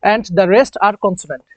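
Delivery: very slow, word by word; slow dictation. Shown as a demonstration. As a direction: dictate it so students can write. Teacher dictating a note.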